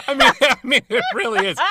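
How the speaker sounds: laughing. Real, not acted. Laughing at joke.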